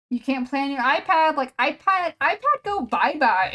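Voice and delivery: put-on loud sing-song dad scolding a kid. Dad telling his toddler the iPad is gone, loud mock baby talk. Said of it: "And the dad is like".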